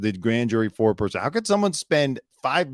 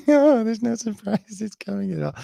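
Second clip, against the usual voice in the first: high, giggly parody voice; high-pitched mock amusement; giggly mocking imitation; 11 semitones higher, 4 dB louder than usual. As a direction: mimic the interviewee straight out of a laugh. Mocks interviewee after a laugh.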